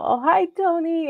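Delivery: high, giddy little greeting, retold. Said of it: "all giddy".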